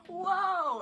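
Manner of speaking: loud, high imitated whoa; startled reaction. Imitating their reaction to the Dan mask, startled. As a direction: exclaim it big, as if taken aback. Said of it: "their reaction to the Dan mask is".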